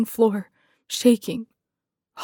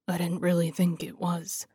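low-key, softer mutter; 3 semitones lower, 7 dB quieter than usual. A narrator's reading. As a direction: mutter it under your breath. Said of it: "he muttered".

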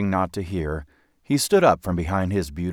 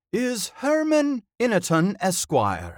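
high, loud theatrical proclamation; eloquent bellow; 13 semitones higher, 4 dB louder, slower than usual. A narrator's reading. Old actor introduces himself to boy, bellowing eloquently. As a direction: bellow it like a grand old thespian. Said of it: "the old one bellowed eloquently".

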